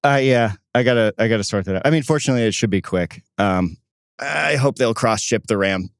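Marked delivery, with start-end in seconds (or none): none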